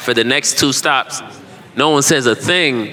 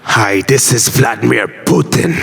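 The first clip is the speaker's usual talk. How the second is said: villain voice. Pretend. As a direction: menace like a cartoon villain. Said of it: "I only got one villain voice".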